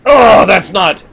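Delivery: loud, drawn-out outraged "oh"; mock indignation. Mimicking people upset by fake accents, mock indignation. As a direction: imitate an affronted complainer, slow and loud.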